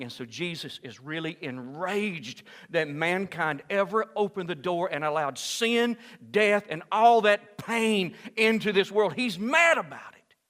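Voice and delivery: builds from steady to loud and high; slow, swelling preacher's cry; rising to a shout. Real, not acted. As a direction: climb in pitch and volume until shouting. Preacher describing Jesus enraged.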